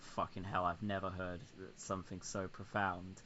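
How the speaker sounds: low, hushed amazement; mock awe. Onlookers marvelling at the president, hushed mock awe. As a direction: drop right down as if stunned by wisdom. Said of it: "everyone's like".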